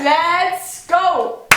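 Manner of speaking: punchy, together this time; better-timed intro call. Shown as a demonstration. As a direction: hit it on the beat. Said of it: "schon ein bisschen besser". (besser getimter Startruf)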